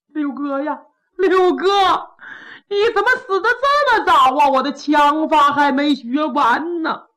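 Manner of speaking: high, loud mourning cry; acted wail, bursting upward. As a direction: bawl it out over the dead. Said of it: "也大放悲声".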